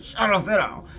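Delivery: sharp high command to the dog; mock stern order; retold command. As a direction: bark it at a puppy. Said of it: "I said".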